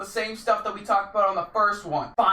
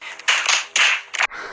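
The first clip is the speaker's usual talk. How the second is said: breathy laugh; 5 dB louder than usual. Real, not acted. Laughing after his greeting.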